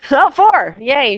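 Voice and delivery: laughing, high and loud cheer; delighted. Real, not acted. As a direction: burst out with real joy. Teacher delighted by more nods.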